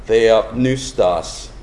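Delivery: theopneustos said slowly, syllable by syllable; Greek word slowly. Shown as a demonstration. Teaching the congregation the Greek, slow and careful. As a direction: spell out theopneustos slowly for the class. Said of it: "that word inspired is".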